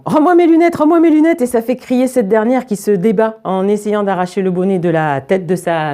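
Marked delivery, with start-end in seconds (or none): 0.0-1.4 put-on high child's yell; high-pitched acted shouting; screaming. A narrator's reading. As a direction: yell the demand, pitched well up. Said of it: "en hurlant".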